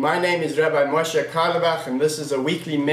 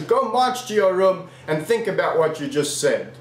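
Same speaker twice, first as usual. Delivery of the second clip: ordinary delivery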